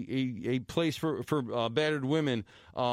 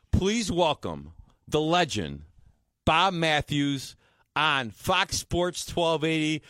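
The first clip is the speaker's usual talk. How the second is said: slow, loud radio introduction; announcer voice; 4 dB louder, slower than usual. Acted. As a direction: welcome the legend like a host. Introducing the guest on air.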